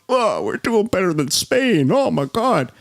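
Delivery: lightly voiced mocking quote; mock politician. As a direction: mimic him with a hint of mockery. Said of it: "you're going on here on C N N going".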